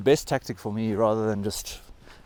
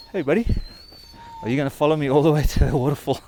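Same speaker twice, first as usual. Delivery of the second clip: high and louder, quick pet talk; talking to a dog; 4 semitones higher, 6 dB louder, faster than usual. Real, not acted. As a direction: cheerfully tease a dog that follows. Dog following him.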